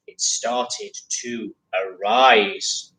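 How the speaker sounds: slow, dramatic storytelling; slow menace. Acted. Teacher reads the bear rising to the class, slow menace. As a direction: draw it out with menace.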